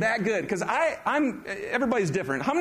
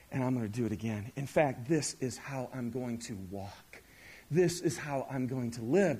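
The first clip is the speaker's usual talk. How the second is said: quiet earnest; 6 semitones lower, 6 dB quieter than usual. Real, not acted. A pastor quietly tells the congregation his resolve, soft and sincere. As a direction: lower it and mean every word.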